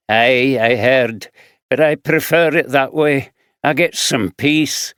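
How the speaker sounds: lightly voiced rough Glaswegian man; gruff Glaswegian drifter answering; Glaswegian accent. Fraser voiced.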